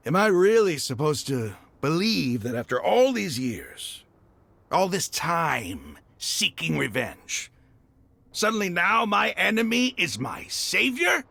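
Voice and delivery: rising from tense to a full angry shout; he can't accept his enemy saved them, and it boils over; climbing to a furious, disbelieving shout; anguished outburst. Acted. Bitter outburst at the revelation.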